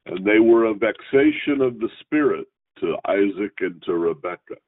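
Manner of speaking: measured, formal reading of the Torah text; scripture reading. Acted. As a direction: read each verse out steadily like in synagogue.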